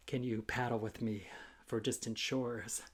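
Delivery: soft hushed whisper. Acted. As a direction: whisper the invitation to paddle. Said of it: "whispered to listener".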